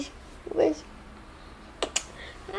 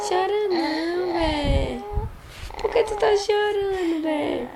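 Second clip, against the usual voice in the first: tender soothing; 7 semitones higher than usual. Acted. Adult soothing crying child.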